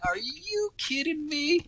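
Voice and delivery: high, slow, stretched-out disbelief; you cannot believe what you just read; incredulous. Real, not acted. Outraged disbelief at media spin.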